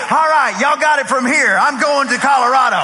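loud acted sign-off to a crowd; announcing an exit. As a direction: call out a hasty handover. Acted exit line over the commotion.

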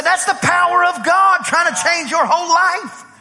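high-pitched, re-enacted preaching fervour; fervent exclamation. Preacher to man falling under prayer on the beach, fervent exclamation. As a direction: proclaim it to the man with rising zeal.

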